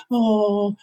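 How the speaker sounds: put-on high cooing voice to a baby; baby talk. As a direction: coo it sweetly like at a baby. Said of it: "That's how we talk to babies".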